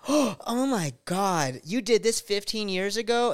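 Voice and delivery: gasp, then drag out the scandalized disbelief; hammed-up scandalized reaction; mock shock. Acts out shocked critics.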